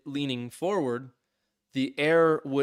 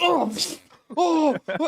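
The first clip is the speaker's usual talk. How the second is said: very high, loud imitated yelps of alarm; mock startled cries; 6 dB louder, slower than usual. Neighbor spills wine up his nose, high startled yelps. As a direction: yelp like wine just hit your nose.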